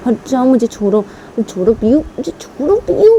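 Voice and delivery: rising, loud cry to be let go; struggling shout. Acted. Ruhi छूटने के लिए चिल्लाती है. (छूटने के लिए बढ़ती ऊँची चीख)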